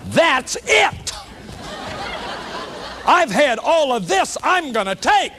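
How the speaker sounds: half-laughing mock shout of someone who has had enough; mock fed-up outburst. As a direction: burst out like your last straw just snapped. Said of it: "they start screaming and hollering and raging".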